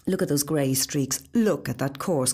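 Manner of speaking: ordinary delivery, nothing marked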